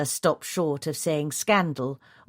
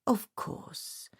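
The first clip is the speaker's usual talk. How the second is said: soft reply; 5 semitones higher, 9 dB quieter than usual. A narrator's reading. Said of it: "Phillida replied".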